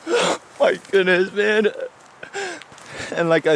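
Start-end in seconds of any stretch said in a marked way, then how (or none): 0.1-1.7 slow, raised tearful voice; overcome, draw it out through tears; real crying. Real, not acted. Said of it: "I'm sorry for crying".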